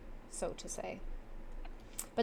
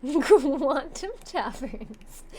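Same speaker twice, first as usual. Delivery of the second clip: high, slow laughing repeat; laughing echo; 5 semitones higher, 4 dB louder, much slower than usual. Real, not acted.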